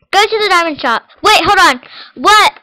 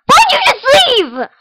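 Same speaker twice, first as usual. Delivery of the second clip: high slow shout; screaming at sister; 10 semitones higher than usual. Real, not acted. Kid shouting at her sister who left the game. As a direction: yell after her for leaving. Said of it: "I'm literally screaming at you".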